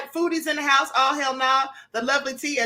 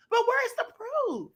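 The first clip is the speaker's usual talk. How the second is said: sassy challenge; 9 semitones higher, 4 dB quieter, slower than usual. Real, not acted. Challenging her rival.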